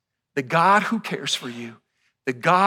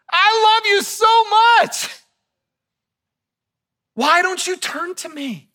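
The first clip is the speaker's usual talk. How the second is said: high, loud, slow and yearning; God loving plea; 7 dB louder, slower than usual. Acted. Voicing God's love to his people, high and loud. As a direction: cry it out like a loving father. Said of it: "all God was saying is".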